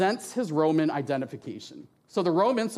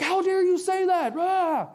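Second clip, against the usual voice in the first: high-pitched indignant squawk ending in a yelp; mock outrage. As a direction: act out an offended crowd, voice shooting up high.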